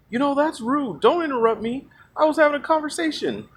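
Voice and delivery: high, soft whiny protest; mock upset. Upset guy scolding those who interrupted him, high mock whine. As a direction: imitate a put-out guy in a high voice. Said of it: "he got really upset".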